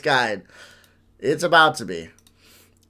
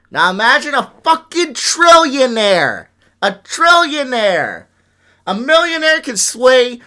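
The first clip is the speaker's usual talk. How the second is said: higher, louder, real alarm; loud disbelief; 8 semitones higher, 6 dB louder than usual. Real, not acted. Imagining what a trillionaire could sway, loud disbelief. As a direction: raise the voice on each "trillionaire".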